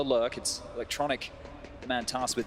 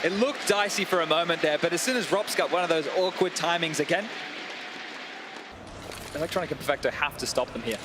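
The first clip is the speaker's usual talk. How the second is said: esports caster. Real, not acted. Live play-by-play casting.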